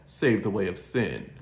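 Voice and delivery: ordinary delivery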